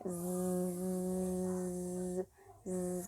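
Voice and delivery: low, soft, long buzzing zzz; drawn-out sleeping buzz; snoring zzz. Shown as a demonstration. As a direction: hum out a sleepy snore. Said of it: "Estamos dormindo".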